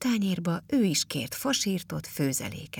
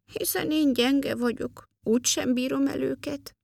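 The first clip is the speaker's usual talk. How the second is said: small, very high, forlorn elf voice; high-pitched little voice, giving up sadly; saddened; 9 semitones higher than usual. A narrator's reading. Elf doubts he can lift the stones, high and saddened. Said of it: "el is szomorodott".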